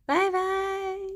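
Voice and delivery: high, sing-song bye-bye. Acted. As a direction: chirp a cutesy goodbye. Final goodbye after stream ends.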